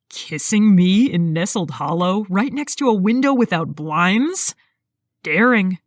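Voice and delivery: lightly voiced man, teasing; man's voice. A narrator's reading. Man teasing his partner.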